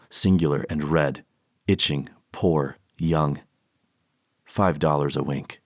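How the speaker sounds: a low, grave reading of dark verse; poem reading. Acted. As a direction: read each verse as a somber poem. Reads poems aloud.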